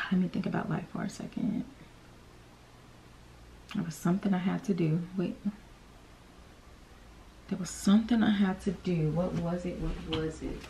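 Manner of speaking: soft, half-whispered thinking aloud; trying to remember, under breath; muttering to herself. Real, not acted. Musing to herself quietly.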